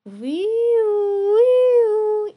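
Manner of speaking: rising loud siren sound; siren wail. Acted. Fire engine's siren wailing high and loud. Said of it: "la sirena sonaba".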